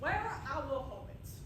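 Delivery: ordinary delivery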